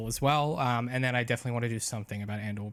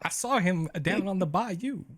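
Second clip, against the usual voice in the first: higher mock Southern voice; lightly Southern, pitched up; Southern accent; 7 semitones higher than usual. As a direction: spin a bayou yarn in Southern. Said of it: "Southern for me becomes Christopher Walken".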